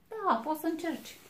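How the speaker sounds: high, fast, squeaky piglet voice; little piglet. A narrator's reading. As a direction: chirp it out like a tiny pig. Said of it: "i-a spus porcușorul".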